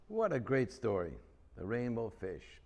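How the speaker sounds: ordinary delivery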